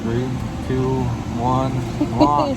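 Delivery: countdown building to a shouted launch; rocket countdown. Pretend.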